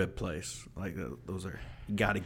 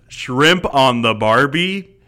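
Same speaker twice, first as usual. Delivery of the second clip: slow, much louder mock Australian accent; 4 semitones higher, 11 dB louder, slower than usual. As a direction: ham up a bad Aussie accent. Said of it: "that's my accent".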